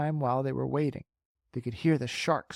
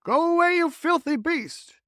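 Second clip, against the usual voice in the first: loud high yell, slowing on the insult; shouted; 6 dB louder than usual. Acted. Crew yelling at the shark, loud and high. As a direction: scream it at the beast. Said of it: "they shouted".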